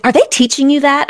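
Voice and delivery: high, fast suspicious demand; mother's high livid question to her son. Retold or imitated. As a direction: demand to know who taught him. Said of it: "I was like".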